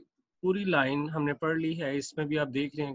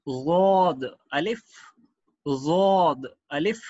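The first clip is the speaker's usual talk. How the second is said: slow reading; 5 dB louder, slower than usual. Shown as a demonstration. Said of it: "next पढ़ते हैं".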